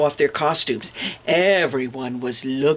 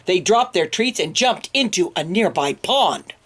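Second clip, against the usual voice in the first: ordinary delivery, nothing marked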